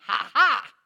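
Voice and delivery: loud mock "ha ha"; loud acted gotcha laugh; gloating laugh. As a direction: burst out with a triumphant two-beat laugh. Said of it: "jumped out from behind the bush and went".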